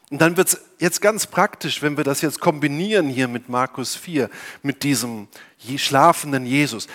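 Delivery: usual speaking voice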